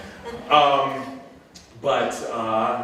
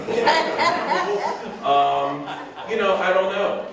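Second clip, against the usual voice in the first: laughing; 12 semitones higher, 5 dB louder, much slower than usual. Real, not acted. Laughs about porn star answer.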